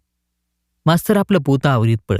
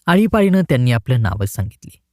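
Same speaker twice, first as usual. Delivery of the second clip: plain reading voice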